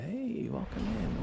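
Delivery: ordinary talk, nothing marked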